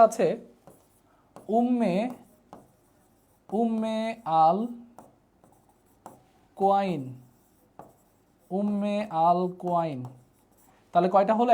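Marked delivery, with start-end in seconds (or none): 1.5-10.0 slow syllable-by-syllable. Shown as a demonstration. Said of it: "spelling out a name slowly".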